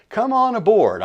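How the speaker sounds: an eager, beaming welcome; excited call. Real, not acted. Host calling viewers to subscribe.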